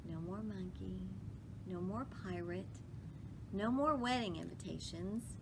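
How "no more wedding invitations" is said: ordinary delivery, nothing marked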